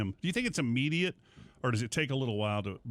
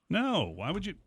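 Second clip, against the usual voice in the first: mock guilty denial; 7 semitones higher than usual. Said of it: "You'll go".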